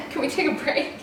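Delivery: on the edge of tears, asking to stop; starting to cry. Real, not acted. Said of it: "made you cry".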